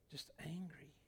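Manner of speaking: breathy whisper, almost to himself; a whispered sigh of dismay. Acted. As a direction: let it drop to a whisper. Quiet aside after angry Christians.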